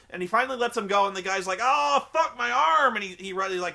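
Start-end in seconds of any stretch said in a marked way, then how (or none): 1.6-2.9 loud, high, drawn-out wail; hammed-up agony; mock pained yell. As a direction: imitate a wrestler howling over an injury. Imitating man clutching hurt arm.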